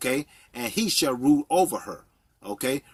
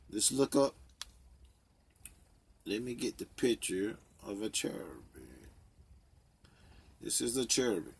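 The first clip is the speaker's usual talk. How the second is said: normal talking voice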